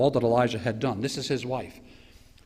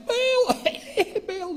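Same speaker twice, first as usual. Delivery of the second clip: high, worn-out mock chant; about to pass out. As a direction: cry out as if nearly collapsing. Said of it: "they're about to pass out".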